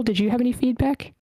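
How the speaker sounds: normal talking voice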